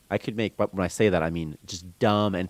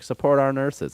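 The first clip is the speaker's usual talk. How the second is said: mock slogan; 7 semitones higher, 4 dB louder than usual. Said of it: "They were like".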